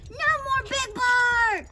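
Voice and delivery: loud, high, drawn-out cheer. A narrator's reading. Crowd celebrates the bully's capture, cheering. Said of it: "the crowd cheered".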